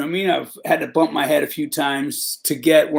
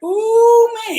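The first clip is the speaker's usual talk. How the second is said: much higher, loud put-on voice for an excited inmate; mock inmate voice; 4 dB louder than usual. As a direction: squeal it out like a gossiping fellow inmate. Inmates gossiping, quoted.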